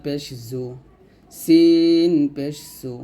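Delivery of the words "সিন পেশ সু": সিন পেশ সু drawn out, a little louder; slow letter drill; slow demo syllable. Shown as a demonstration. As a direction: say সিন পেশ সু slowly and clearly. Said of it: "যেমন".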